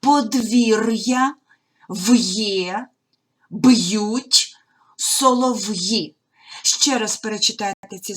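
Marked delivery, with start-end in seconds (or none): 0.0-6.1 Подвір'я and солов'ї drawn out slowly with hard consonants and a break before the vowel; separated pronunciation. Shown as a demonstration. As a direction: model each apostrophe word slowly and firmly. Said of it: "приголосні читаємо твердо".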